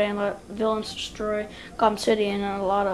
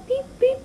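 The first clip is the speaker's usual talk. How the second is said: very high-pitched little beeps; imitated computer beeps. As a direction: squeak out two computer alert tones. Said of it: "What's wrong, Bat computer?".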